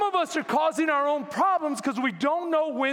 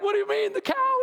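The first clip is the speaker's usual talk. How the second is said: high-pitched, worried bewilderment; nervous questioning; 9 semitones higher than usual. Retold or imitated. Worried man questioning his pastor, acted nervous and high. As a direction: act out a fretting man, pitch leaping up. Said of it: "the man gets nervous 'cause he's worried".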